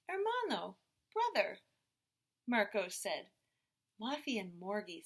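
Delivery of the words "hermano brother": put-on toddler voice. A narrator's reading. Toddler naming siblings.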